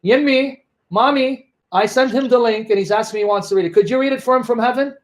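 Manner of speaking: mocking call to mother in heaven. Mock call to dead mother.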